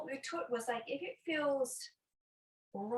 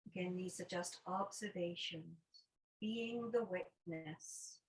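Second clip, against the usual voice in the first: guided meditation. Acted. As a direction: guide them unhurriedly into noticing the breath. Leads a breathing meditation.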